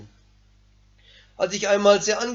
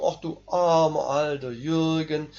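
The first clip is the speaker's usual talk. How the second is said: slow, low mock-tender coo; 7 semitones lower, 3 dB quieter, slower than usual. Preacher mockingly coos to his flesh like a baby, slowly. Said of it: "schaukele es wie ein kleines Baby".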